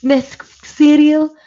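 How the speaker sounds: put-on high, loud, halting Scouse child accent; slow Scouse attempt, pitched high with pauses between phrases; Scouse kid attempt. Shown as a demonstration. Trying the advert line on request, high halting Scouse. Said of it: "there was a Scouse kid on it".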